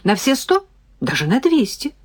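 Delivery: mildly indignant, pitched high; irritated. A narrator's reading. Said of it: "рассердилась я".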